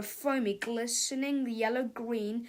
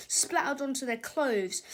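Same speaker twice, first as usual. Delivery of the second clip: ordinary delivery, nothing marked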